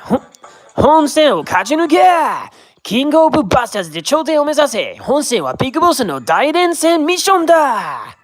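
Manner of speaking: high, punchy announcer call; shouty Japanese promo voice; game announcer hype. Retold or imitated. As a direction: pump up the players like a game announcer.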